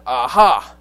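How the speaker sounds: loud triumphant aha; spring out and catch her out; gotcha exclamation. Retold or imitated. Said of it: "jumped up from behind the bush and said".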